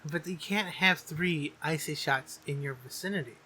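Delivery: normal talking voice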